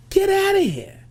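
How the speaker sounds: very high, loud outburst; acted burst of anger; exasperated yell. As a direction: yell it to throw him out. Acted urge to throw him out.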